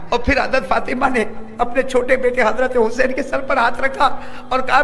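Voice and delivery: ordinary talk, nothing marked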